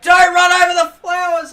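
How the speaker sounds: high loud yell; family yelling. Retold or imitated. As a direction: yell a warning about the flowers. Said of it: "the whole family was like".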